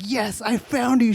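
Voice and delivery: high-pitched mock-triumphant mom. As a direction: imitate a corny mom crowing in victory. Retold corny mom's triumphant arrival.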